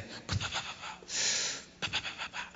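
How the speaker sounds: soft unvoiced puffing "pa pa pa"; sangre que brota. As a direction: imitate spurting blood in rapid whispered pops. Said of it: "y la idea hace". (sonido imitado de sangre brotando)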